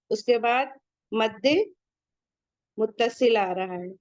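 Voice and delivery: ordinary talk, nothing marked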